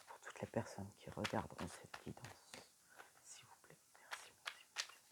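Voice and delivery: breathy whisper to the cards; whispered invocation. Real, not acted. Reader whispers her request to the oracle. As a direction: murmur the request and thanks like a prayer.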